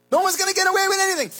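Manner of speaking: preacher's fiery shout; shouted warning. Acted. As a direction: blast the warning out. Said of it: "repeated louder".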